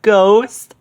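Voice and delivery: high, loud scared Shaggy; lightly voiced scared Shaggy. A narrator's reading. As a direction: yelp it like a jumpy teen. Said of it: "said Shaggy".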